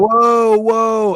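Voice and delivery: loud stunned whoa; shocked whoa. Retold or imitated. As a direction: recoil in shock. Reacting to the kid yelling.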